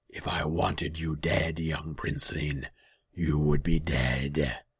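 very slow, loud, gloating demon; heavy, slow creature voice. A narrator's reading. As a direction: draw out the confession menacingly. Demon scorns the prince.

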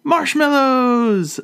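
high, loud, drawn-out shout of joy; excited shout. A narrator's reading. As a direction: cry out with delight. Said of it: "Elizabeth shouts with excitement".

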